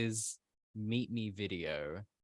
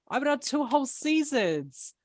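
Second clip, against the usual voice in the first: higher, louder indignant protest; mock rant; 6 dB louder than usual. Player mock-ranting about her two seasons. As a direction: stretch whole seasons in a huffy rant. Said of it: "This is my rant era".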